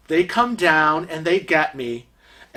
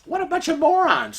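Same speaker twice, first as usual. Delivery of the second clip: scornful mockery; 12 semitones higher, faster than usual. Mocking the staff, high voice.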